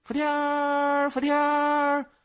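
high, loud, long-drawn insect call; shrill out each cry like a cicada, stretched and held high. A narrator's reading. Pet cicada calls its own name, piercing drawn-out cry. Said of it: "我的福天儿叫了起来".

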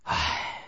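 long, low, soft sigh. A narrator's reading. Hesitant father answering his son's urging, sighing. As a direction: heave a quiet, weary sigh. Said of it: "叹了一口气".

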